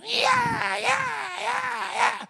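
very high, loud, shrieking demon voice; demon's screech. Retold or imitated. Demon cries out as hands are laid on, screech. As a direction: imitate a demon screeching as it is cast out. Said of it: "this demon said".

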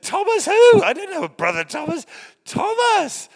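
baffled outcry. Retold or imitated. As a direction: shout out your confusion, high and loud. Retold baffled reply to pastor.

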